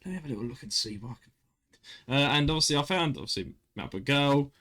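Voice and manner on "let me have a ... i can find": a lightly creepy, hushed, fast mutter to himself; mock-sinister, half under the breath. Imitating himself browsing dating apps in a mildly creepy voice. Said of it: "you said it in a really creepy voice".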